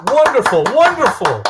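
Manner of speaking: high, loud gushing praise; mock rapture. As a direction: gush over the song, hammed up. Mock applause for trolls' song.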